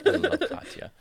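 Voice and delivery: echoing a friend's words in a high squeak. Pretend. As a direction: echo the line up high. Echoing in put-on voice.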